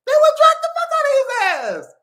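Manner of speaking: high, loud, fast squeal of glee; mock outrage. Mock retelling of men dragging someone.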